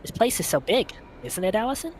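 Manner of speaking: giddy, high-pitched and quick; excited high voice. Acted. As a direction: gush about going out to sea. Saturday excited about the ferry.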